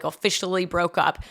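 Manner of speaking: normal talking voice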